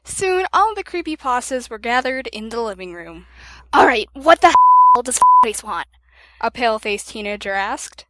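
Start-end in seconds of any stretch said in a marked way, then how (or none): 3.7-5.9 put-on annoyed teen. A narrator's reading. Rude teenager demands answers.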